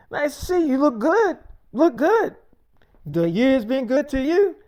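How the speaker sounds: high, sly devil voice; Lucifer voice. Retold or imitated. Lucifer greeting his brother, in a high sly voice. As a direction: put on a high, smug voice for Lucifer.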